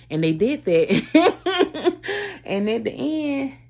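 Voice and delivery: slow, pained laugh through the words. Real, not acted. Telling viewers she lost everything, laughing ruefully. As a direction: laugh so you won't cry.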